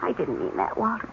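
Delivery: soft apology. Acted. Wife apologizing to Walter for her bitter remark, softly. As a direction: take it back gently.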